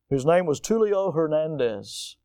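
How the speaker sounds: normal talking voice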